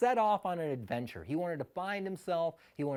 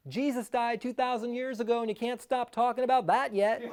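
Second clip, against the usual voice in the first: blurted comeback; 7 semitones higher than usual. Retold or imitated. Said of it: "I just go".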